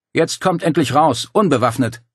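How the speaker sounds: called command; calling out. Acted. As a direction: command them outside. Dario ordering them out.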